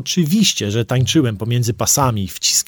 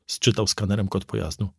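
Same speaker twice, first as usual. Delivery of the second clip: ordinary delivery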